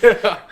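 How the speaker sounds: loud high laugh trailing into yeah; burst of laughter. Real, not acted. Friend laughing at the boss bit, loud burst of laughter.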